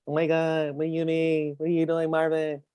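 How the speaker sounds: a hint of an exasperated Kevin Hart, incredulous. Host mocking a doubting viewer, lightly imitating a Kevin Hart voice. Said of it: "I'm gonna do my Kevin Hart voice".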